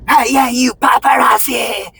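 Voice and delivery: high hostile mock yell. Market guy yelling at me, high mock yell. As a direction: yell it at him, hammed-up. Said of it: "He yelled out".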